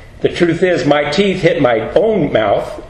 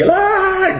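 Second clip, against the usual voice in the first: put-on girl's shriek. Little girl startled at blood.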